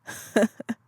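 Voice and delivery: short fond laugh; a light chuckle from the old woman; elder's laugh. Acted. Luma laughs at Kavala's devotion, a light chuckle. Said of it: "Luma laughed".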